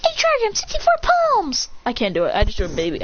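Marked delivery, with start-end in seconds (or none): 0.0-1.7 put-on high kid voice; Hinata. Shown as a demonstration. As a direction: put on a squeaky child's voice. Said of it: "I just do a kid voice".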